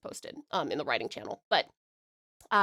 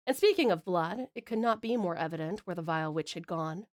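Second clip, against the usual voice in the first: measured, lower-pitched fantasy narration; story narration; 3 semitones lower than usual. A narrator's reading. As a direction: read it aloud as a dramatic storyteller. Reads submitted fantasy story aloud.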